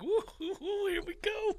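high, soft mock "woo, woo". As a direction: tease with a high, breathy "woo". Mock excited reaction.

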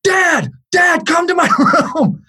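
high-pitched imitation of a panicked kid; retold high, urgent yell; mock scared yell. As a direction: call out for Dad like a frightened son. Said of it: "He never yells".

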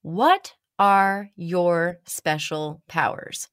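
slow, weighty reading of a strange note; each word laid out slowly for effect; dramatic slow read. Retold or imitated. Reading the stranger's napkin note to the listener, slow and dramatic. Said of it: "dramatic pause".

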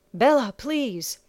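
slow desperate plea; pleading. Acted. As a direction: beg her slowly, urgently. Pleading to be untied.

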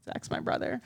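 ordinary talk

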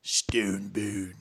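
low whispered stone gravel voice; gargoyle whisper. Pretend. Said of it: "That's why a dude be like".

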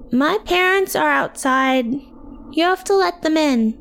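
put-on high-pitched little girl; tiny child's voice. A narrator's reading. Little Sue asks teacher to open up, cooing. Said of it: "Sue's tiny voice cooed".

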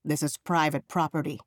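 ordinary talk

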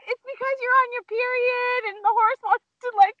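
very high squeal through laughter; retold quote squeaked out helplessly; cracking up. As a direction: barely get it out for laughing. Retold husband's theory, teller cracking up.

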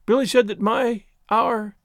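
high-voiced naive girl, confessing; distraught young woman. A narrator's reading.

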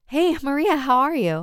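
hopeful, calling out to her friend; eager greeting. Acted.

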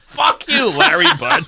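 high, squeaky Larry Bud impression; Larry Bud Melman voice. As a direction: do Larry Bud's recorded message. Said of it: "that says".

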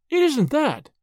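high-pitched, understated hysteria; gasped, almost hysterical. A narrator's reading. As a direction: protest high, on the edge of losing control. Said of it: "gasped Tuppence with an almost hysterical laugh".

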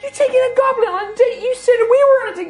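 high-pitched acted outrage; outraged woman. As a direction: shriek at your date as a jilted woman. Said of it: "this woman".

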